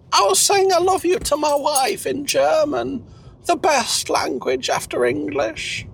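high-pitched, slowing character voice; Cecil, explaining himself. Acted. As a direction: explain proudly in a high put-on voice. Plays Cecil.

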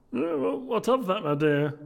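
high, loud put-on young gentleman; merry nephew. Nephew telling guests about his comical uncle, high loud voice. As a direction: pitch it high and project. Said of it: "said Scrooge's nephew".

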